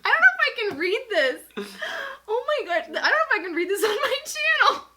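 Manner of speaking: high, fast laughing near tears; mortified laughter. Real, not acted. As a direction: laugh helplessly out of embarrassment. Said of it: "I can't believe I was thirteen".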